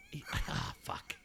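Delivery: strangled high gasp collapsing to a whispered fuck; exasperated groan. Real, not acted. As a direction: choke on it, then breathe out a hushed curse.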